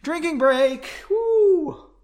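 high loud cheer; excited cheer. Real, not acted. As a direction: whoop it out with glee. Reader cheers for drinks.

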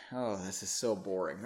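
usual speaking voice

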